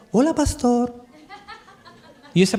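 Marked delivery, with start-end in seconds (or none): 0.1-1.0 women's disguised voices. Retold or imitated. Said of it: "Las mujeres decían".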